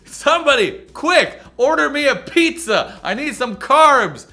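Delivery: loud, high, drawn-out food demand; hammed-up hungry plea. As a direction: cry out for food like you're starving. Hungry dieter calls out for food.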